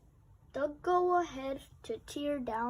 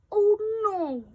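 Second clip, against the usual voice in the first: louder, higher cry of dismay; 7 semitones higher, 10 dB louder than usual. A narrator's reading. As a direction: gasp at the broken playground. Dismay at ruined playground.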